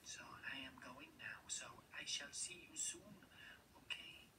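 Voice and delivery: hushed spy whisper, sneaking off; secret whisper. Acted. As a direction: whisper the goodbye like someone being watched. Camel leaving under investigation.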